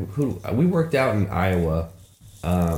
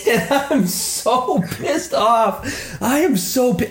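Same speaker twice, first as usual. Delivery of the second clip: laughing through the words, much higher and slow; 13 semitones higher, much slower than usual. Real, not acted. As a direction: crack up while you say it. Laughing while telling of cop.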